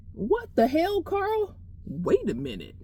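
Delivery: incredulous. Retold or imitated. Alarmed reaction to Carl.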